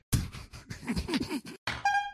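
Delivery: high, breathless squeal of laughter; wheezing laugh. Real, not acted. Reader cracks up at the absurd story, wheezing laugh.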